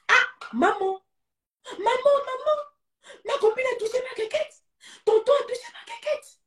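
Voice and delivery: put-on high, crying child calling for mum; a child's tearful high-pitched plea; distressed child. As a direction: whimper it like a scared little kid. Child reporting abuse to mother.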